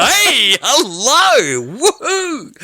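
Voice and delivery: high, loud comic whooping; playful high-pitched yoo-hoo; mock excited hailing. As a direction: call out in a hammed-up high voice. Jokingly acts out a saucy greeting.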